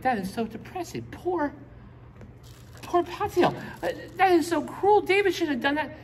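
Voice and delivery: high, whiny mock sympathy for poor Paltiel; mock pity. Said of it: "You're like".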